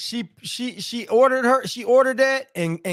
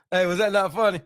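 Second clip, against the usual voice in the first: usual speaking voice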